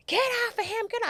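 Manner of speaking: high, loud shout to let go; retold yell. Boy's mother yelling to release her son. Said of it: "She's like".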